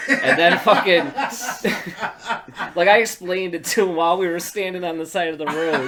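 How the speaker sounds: loud, high telling through laughter; cracking up. Real, not acted. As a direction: keep telling it while really laughing. Teller cracking up mid-story.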